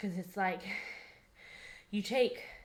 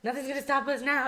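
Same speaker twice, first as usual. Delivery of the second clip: put-on high, eager little kid; excited kid voice; 5 semitones higher than usual. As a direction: cheer like an excited child. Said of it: "like a little kid".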